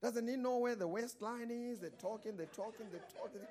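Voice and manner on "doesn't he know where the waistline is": lightly voiced tutting auntie; high, quiet mocking gossip; gossiping old couple. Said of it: "in Afrikaans".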